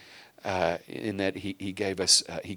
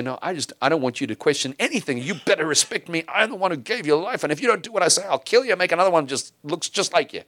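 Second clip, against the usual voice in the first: higher and louder, a hammed-up exasperated tirade; mock annoyed parent; 8 semitones higher, 5 dB louder than usual. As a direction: lampoon the parent who won't answer questions. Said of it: "we're annoyed at those little questions".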